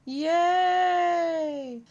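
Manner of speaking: high, cheering yay; mock cheer. Crazy person cheering at missed shots, yelled yay. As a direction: throw up a gleeful yay. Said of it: "they scream".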